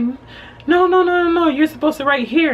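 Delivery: high, loud, hurried no no no; insistent correction. Retold or imitated. Worker correcting her form, insistent high mock voice.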